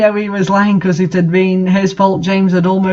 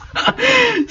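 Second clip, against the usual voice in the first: squeaky high laugh breaking out; a helpless high-pitched giggle; high burst of laughter; 3 dB quieter than usual. Real, not acted. Reader cracks up at the fanfic, high giggle.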